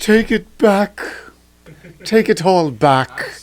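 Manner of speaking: slow, loud, drawn-out take it back; hammed-up outrage at the prima donna jab; mock dramatic demand. Friend mock-demanding an apology, slow loud and hammy.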